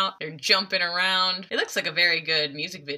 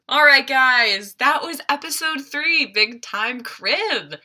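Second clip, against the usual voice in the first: outro announcer; 7 semitones higher, 5 dB louder, slower than usual. Real, not acted.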